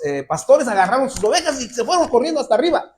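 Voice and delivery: ordinary talk, nothing marked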